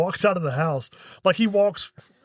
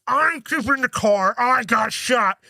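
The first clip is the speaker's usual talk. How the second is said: slow, pitched-up whiny insistence; mock stubborn whine; 5 semitones higher, slower than usual. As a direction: drag it out like a sulky crook. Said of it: "Buscemi's like".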